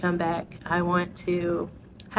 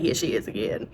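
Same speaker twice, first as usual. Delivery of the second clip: low, soft mutter; weary doctor; 9 semitones lower, 5 dB quieter than usual. Acted. Doctor seeing her walk in, muttered mock dismay. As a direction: grumble it under your breath. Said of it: "She'd be like".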